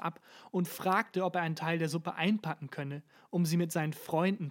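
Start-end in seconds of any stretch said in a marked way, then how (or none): none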